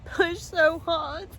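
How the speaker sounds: high, sobbing voice with tearful laughs; ugly crying, words breaking through sobs; snotty crying. Real, not acted. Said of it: "I'm doing the proper ugly cry now".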